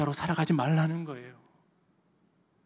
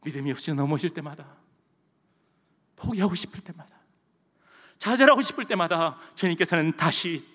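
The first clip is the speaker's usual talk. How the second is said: ordinary delivery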